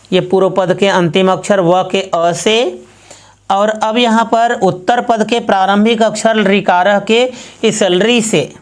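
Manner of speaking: ordinary talk, nothing marked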